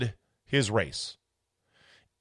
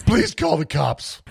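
mock imitation; 4 semitones higher, 4 dB louder than usual.

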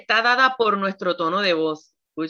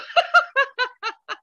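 high laugh. Real, not acted. Bursts out laughing.

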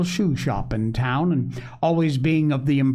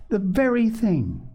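put-on higher-pitched girlish delight; delighted exclamation; 8 semitones higher than usual. A narrator's reading. Polly finding a place for the flowers, delighted.

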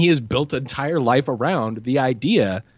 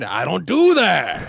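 Pacino line; 3 semitones higher, 4 dB louder, slower than usual. Retold or imitated. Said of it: "he does this".

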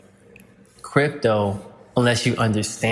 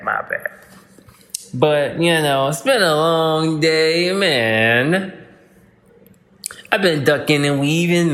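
ordinary delivery

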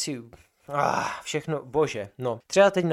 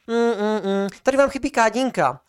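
high, nagging voice of a fussy lab assistant; mocking lab assistant; 9 semitones higher, 5 dB louder than usual. Lab assistant nitpicking the students' bench, high mocking voice. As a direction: mimic her petty complaint, pitched up.

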